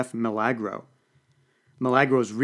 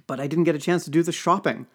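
usual speaking voice

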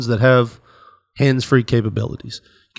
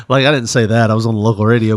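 usual speaking voice

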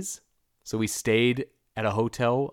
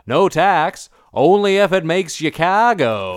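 put-on louder, higher, in a thick Massachusetts accent; thick Boston accent; 6 semitones higher, 7 dB louder than usual. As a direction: say "car go" like a Bostonian. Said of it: "And he goes".

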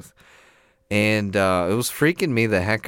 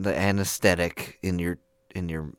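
ordinary delivery

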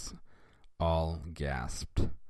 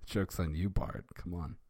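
ordinary delivery

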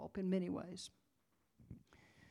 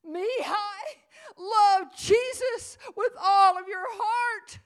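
anguished cry, high and loud; slow, breaking shout of a desperate mother; 11 semitones higher, 15 dB louder, much slower than usual. A narrator's reading. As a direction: cry it out to the child being dragged away. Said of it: "she cries to her little boy".

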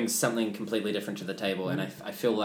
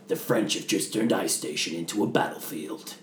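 low, gruff movie trailer read; gravelly trailer voice; 5 semitones lower than usual. Shown as a demonstration. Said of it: "one of my favorites is just the".

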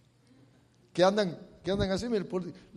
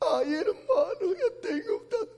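voice breaking; 11 semitones higher than usual. Real, not acted. Preacher choking up.

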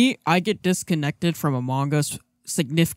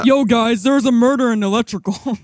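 loud, higher alarmed call-out; excited report; 6 semitones higher, 3 dB louder than usual. Acted. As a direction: announce the body like a panicked player. Calling out report in sketch.

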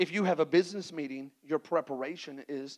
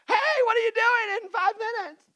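squeaky falsetto, much higher and louder; pushy last-minute friend; 9 dB louder than usual. Acted.

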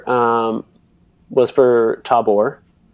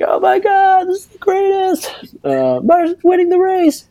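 much higher, falsetto mock fans gushing; excited crowd impression. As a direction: squeal it like awestruck spectators. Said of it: "they're like".

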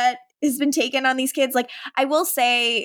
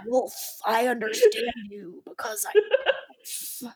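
mock werewolf; 4 dB quieter than usual. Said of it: "werewolves on this show and being like".

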